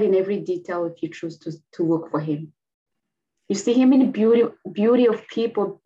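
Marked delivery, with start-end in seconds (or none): 3.5-5.8 fervent emphasis. Real, not acted. Passionate testimony about God.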